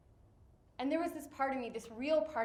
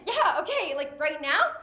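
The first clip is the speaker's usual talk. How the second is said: Julia, incredulous; 5 semitones higher, 11 dB louder than usual. Retold or imitated. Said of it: "she looked at me and she said".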